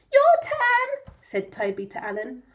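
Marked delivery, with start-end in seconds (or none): 0.1-1.1 high, loud piglet voice; squeaky little piglet. Acted.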